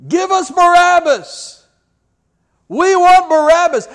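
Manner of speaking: high, loud mob chant; crowd scream. Retold or imitated. Crowd demanding Barabbas from Pilate, screaming. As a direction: yell it like an angry mob. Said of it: "the crowd begins to scream".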